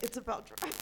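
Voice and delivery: Rock impression. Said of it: "I'm like".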